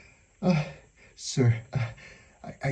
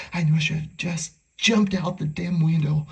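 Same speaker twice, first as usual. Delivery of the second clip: ordinary talk, nothing marked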